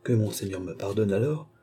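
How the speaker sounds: ordinary delivery, nothing marked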